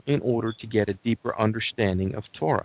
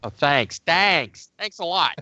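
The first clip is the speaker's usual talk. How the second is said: sarcastic thanks, pitched higher as it repeats; mock offended; 7 semitones higher, slower than usual. Co-host's teasing gets sarcastic thanks, mock offended. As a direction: play at being affronted, rising in pitch. Co-host jokes at his expense.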